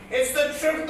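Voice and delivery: usual speaking voice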